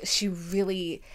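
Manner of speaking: ordinary delivery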